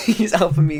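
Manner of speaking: high and loud through laughter; laughing. Real, not acted. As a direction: laugh as you say it. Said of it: "Why are you laughing?".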